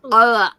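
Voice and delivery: loud, disgusted "Ugh"; mock revolted groan. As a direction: groan in revulsion. Reacting to the razor passage.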